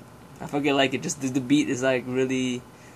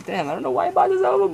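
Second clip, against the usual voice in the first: exasperated; 6 dB louder, faster than usual. Real, not acted. Exasperated about buying album.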